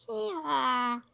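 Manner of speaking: high playful hee-haw bray; donkey bray. A narrator's reading. Reading to a child, mimicking the donkey's high bray. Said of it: "to make them bray".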